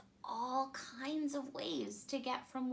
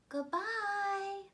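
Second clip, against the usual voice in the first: high, louder farewell; farewell moon; 9 semitones higher, 5 dB louder than usual. A narrator's reading. The moon saying goodbye to the astronauts, high and fond. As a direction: call after the departing ship. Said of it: "the moon said".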